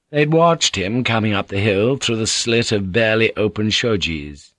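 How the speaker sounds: ordinary delivery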